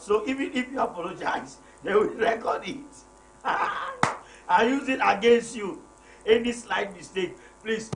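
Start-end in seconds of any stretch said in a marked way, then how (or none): 3.4-4.2 short high laugh mid-sentence; chuckling at women keeping records; real laugh. Real, not acted. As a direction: break into a quick laugh.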